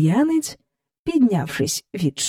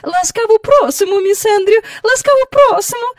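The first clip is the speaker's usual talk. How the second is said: very high, loud effusive greeting; courteous welcome; 6 dB louder than usual. A narrator's reading. Hostess welcoming the visitor, courteous and effusive. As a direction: gush a polite welcome. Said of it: "чемно примовляла вона".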